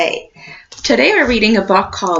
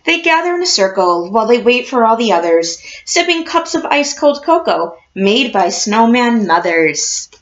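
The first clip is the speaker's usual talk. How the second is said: put-on reading to children; 2 semitones lower than usual. A narrator's reading. Teacher reading a picture book to her class, storybook voice.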